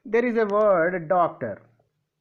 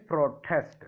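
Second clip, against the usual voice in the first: pro-TEST, weight on the end; light "pro", then hit TEST in protest; stress on second syllable; 4 semitones lower than usual. Shown as a demonstration. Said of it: "second syllable of the first letter".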